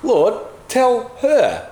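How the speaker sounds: high, slow, pleading demand. Acted. Martha asking Jesus to scold her sister, demanding. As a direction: drag someone else into it. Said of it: "So Martha triangulates".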